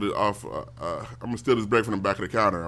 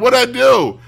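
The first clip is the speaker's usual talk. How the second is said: put-on high, loud startled kid; startled yelp; 11 semitones higher, 9 dB louder, slower than usual. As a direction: cry out after being hit with a shoe. Kid hit with a shoe.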